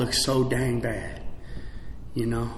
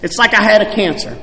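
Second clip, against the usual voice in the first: ordinary talk, nothing marked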